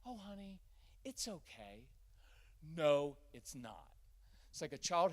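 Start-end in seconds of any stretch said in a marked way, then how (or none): 0.0-1.9 dismissive soothing. Retold or imitated. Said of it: "really irritating when someone's upset". 2.7-3.9 flat retort. Real, not acted. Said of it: "speaker rejects the soothing line".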